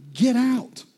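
loud, high mock yell at the prophet; retold yell. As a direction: yell it like a fed-up widow. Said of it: "She yells at the prophet".

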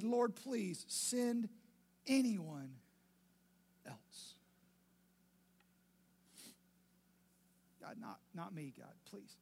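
slow, begging plea that fades into a whisper; beg, then let it die to a whisper; pleading. Acted. Moses begging God to send someone else, slow pleading fading to a whisper. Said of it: "But Moses pleaded".

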